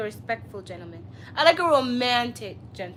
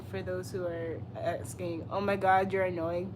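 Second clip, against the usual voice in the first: ordinary delivery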